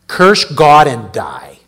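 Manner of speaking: slow, loud, scornful command; scornful wife. Retold or imitated. Preacher voicing Job's wife telling him to curse God, slow and loud. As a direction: spit it out slowly, as Job's bitter wife. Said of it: "What'd she say?".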